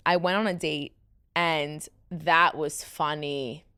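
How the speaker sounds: ordinary talk, nothing marked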